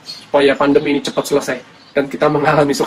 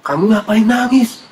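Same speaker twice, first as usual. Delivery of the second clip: higher, slow, drawn-out question; lightly voiced stranger asking, stretched out; questioner at the tomb; 6 semitones higher, slower than usual. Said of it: "di luar kubur ditanya lagi".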